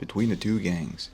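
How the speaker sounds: ordinary delivery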